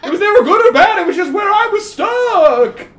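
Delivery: high, loud, whiny nebbish ghost; nervous ghost. Acted. Ghost correcting the player, high, loud and whiny. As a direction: squeak out an indignant protest. Ghost [name] protests.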